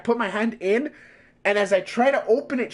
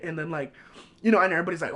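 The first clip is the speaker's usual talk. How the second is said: ordinary talk, nothing marked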